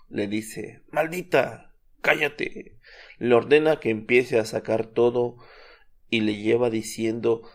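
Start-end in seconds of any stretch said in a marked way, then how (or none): 0.9-2.8 higher, hammed-up demon snarl telling her to shut up; mock demon anger. Narrator voicing the demon cursing the minister: mock anger, higher. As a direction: play the cursing demon, lightly voiced.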